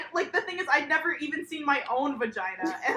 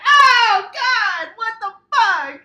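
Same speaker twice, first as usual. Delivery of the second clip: loud, high horrified outburst; shocked cry; 10 semitones higher, 14 dB louder, slower than usual. Retold or imitated. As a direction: recoil in disgust out loud. Seeing the picture for the first time.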